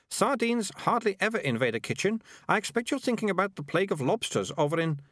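put-on high-pitched, dismissive girl; scornful story girl. A narrator's reading. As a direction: correct them scornfully in a high voice.

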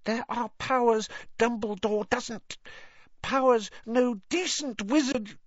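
high, tense elf voice, mock stage whisper; Dobby, urgent confiding. A narrator's reading. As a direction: confide a dark secret as an anxious elf. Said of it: "Dobby's voice dropped to an urgent whisper".

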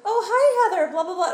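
high loud excited greeting; grandparent impression. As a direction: squeal an eager hello. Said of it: "They're like".

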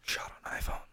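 barely audible whisper. Real, not acted. Podcast host whispering into the mic, whispered. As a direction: whisper it very softly. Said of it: "stop whispering".

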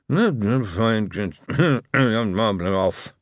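slurred words from under the covers; sleepy mumble. A narrator's reading. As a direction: mumble as if still mostly asleep. Moist half asleep, turning over.

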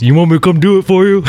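mocking offer. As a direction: needle the struggling new guy. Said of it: "waits about twenty minutes and goes".

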